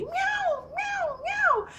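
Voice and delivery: very high imitated cat cries; meowing cat. Cat replying to locked-out owner, high meowing impression. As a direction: mimic a cat answering back, squeaky and insistent. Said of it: "every time I would say something".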